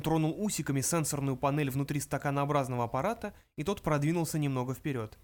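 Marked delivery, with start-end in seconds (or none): none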